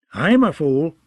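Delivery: mild, cheerful jester announcement; announced happily. A narrator's reading. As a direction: proudly present yourself, lightly. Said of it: "Shagwell announced happily".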